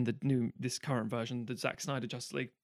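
ordinary delivery

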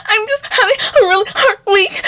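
very high, whimpering imitation of crying; mock crying. Mimicking crying roommate making her excuse, mock tearful whine. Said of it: "she was crying. She was like".

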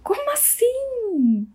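high, slow outraged "como assim"; indignant disbelief. Real, not acted. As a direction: protest in disbelief. Indignant question at partner. (indignada, incrédula)

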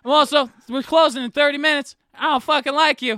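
high, loud mock outburst at the customer; mock shout. Waiter snapping at a rude customer, loud mock outburst. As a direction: blurt it out loud and high, pretend rage.

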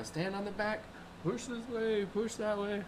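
coaching across the water; yelling instructions. Retold or imitated. Guide shouting directions to the old man on the fence. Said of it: "was able to yell at him".

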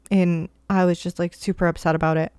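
ordinary talk

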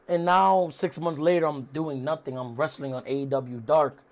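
understated glum retelling; mock dejected. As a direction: imitate a wrestler bemoaning his downfall, fading away. Acted quote of wrestler's downfall.

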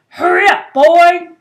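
loud, high impatient shout. A narrator's reading. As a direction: shout it from another room. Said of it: "shouted Uncle Vernon".

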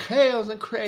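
[name] in an exaggerated posh English accent. Shown as a demonstration. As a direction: put on a proper English accent. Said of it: "tem que falar com sotaque inglês".

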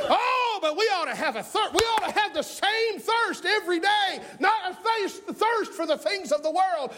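high, driving preacher voice; impassioned pulpit delivery; fired-up preaching. Real, not acted. Preaches at full force.

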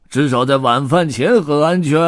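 loud, toothy-grinned character voice; grey-bearded hobo's gleeful jeer; grinning tramp. A narrator's reading. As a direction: gloat loudly through a wide grin. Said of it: "灰胡子龇着牙笑道".

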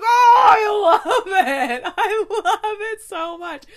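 high, loud, slow squeal with laughter; gushing delight. Retold or imitated. Reviewer reliving the romantic scene, squealing with delight. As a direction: squeal it out like you just read the best scene. Retelling her own reading reaction.

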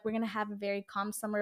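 lightly imitating a calm plan; mild soft mock calm; calm summer voice. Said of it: "or also, like".

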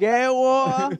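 put-on loud, high European accent; hammed-up European accent, high and loud; mock European accent. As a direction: belt it out in an exaggerated European accent. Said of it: "A European accent".

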